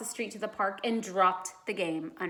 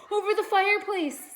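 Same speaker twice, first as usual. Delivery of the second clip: alarmed warning; 12 semitones higher, 6 dB louder than usual. A narrator's reading. Warning Judy of the snake.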